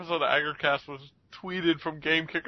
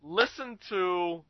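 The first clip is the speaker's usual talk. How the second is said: slow emphatic; 2 semitones higher, 5 dB quieter, much slower than usual. Real, not acted. Urging listeners to hear clip.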